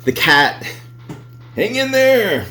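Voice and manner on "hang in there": high sing-song; chirpy poster slogan voice; sing-song cat poster. Acted. Poster child cat motto.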